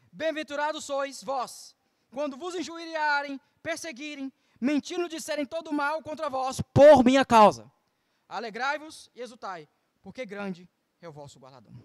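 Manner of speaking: soft, reverent reading of Christ's words; scripture reading. Acted. Preacher quoting Jesus to the church, softly reverent. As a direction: read the verse quietly, with reverence. Reads Beatitudes.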